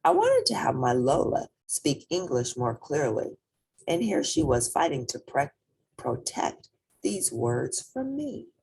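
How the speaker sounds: ordinary delivery, nothing marked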